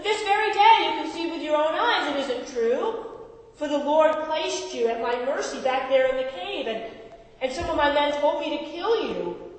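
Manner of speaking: David pleads his innocence to the king at a distance, shouting; shouting to Saul. Acted. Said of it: "he shouted to Saul".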